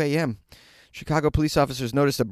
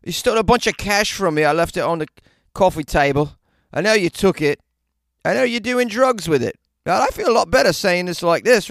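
loud, higher-pitched mock British geezer; hammed-up British accent; 5 semitones higher, 6 dB louder than usual. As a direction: put on a cheeky British accent to confront a roommate. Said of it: "an accent I saw in a Guy Ritchie movie".

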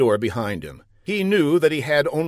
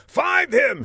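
high, urgent villain's order; high, hammed-up yell; acted yell. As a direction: yell the command with pitch way up. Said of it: "he yelled".

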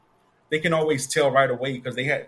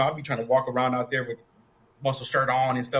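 ordinary talk

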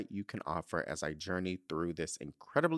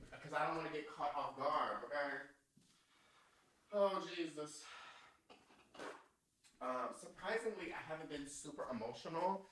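hushed, holding back tears; 6 semitones higher, 10 dB quieter than usual. Real, not acted. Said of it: "Do I need tissues?".

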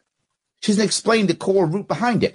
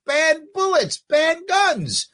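mock politician; 9 semitones higher, much slower than usual. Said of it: "You were like".

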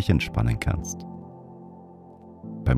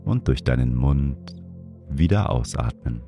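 guided meditation; 3 dB quieter than usual. Acted. Leads a breathing meditation.

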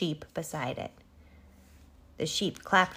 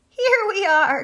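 high, loud gleeful call; sheep's joyful cry; 13 semitones higher, 10 dB louder than usual. A narrator's reading. Sheep dancing on the wool shed steps, crying out. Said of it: "cried the sheep".

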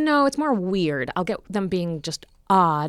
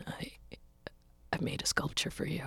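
halting, barely voiced offering; imitating a timid admirer; shy fan whisper; 13 dB quieter than usual. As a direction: whisper it with long hesitations.

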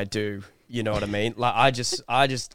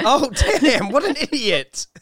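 high, loud laughing jeer; laughing scorn; 10 semitones higher, 4 dB louder than usual. Real, not acted. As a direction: laugh out the insult. Laughing at failed robber.

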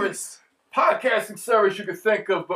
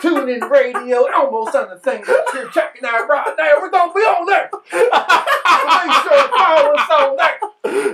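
put-on country accent; 3 semitones higher than usual. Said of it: "in a country accent again".

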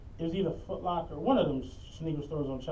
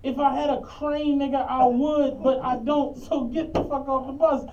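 slow loud retort; 6 semitones higher, 6 dB louder than usual. Retold or imitated. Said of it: "I'm like".

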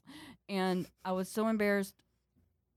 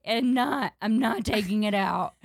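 loud, mildly wailed refusal; retold scream crying; 3 semitones higher, 6 dB louder than usual. Little sister refusing in the bathroom, retold scream crying. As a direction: push it out as a mock-tearful protest. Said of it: "I start scream crying".

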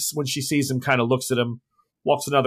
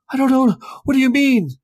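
panicked high denial; 13 semitones higher, 5 dB louder than usual. Acted. GM voices frightened Maurice.

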